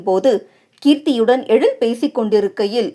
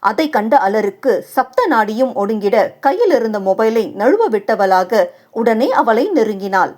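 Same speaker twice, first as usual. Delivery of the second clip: ordinary delivery, nothing marked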